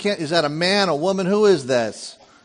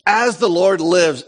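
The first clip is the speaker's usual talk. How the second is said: loud, slow solemn oath; exclamation; 3 semitones higher, 6 dB louder, slower than usual. Acted. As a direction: declare it forcefully, word by word. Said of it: "Exclamation point".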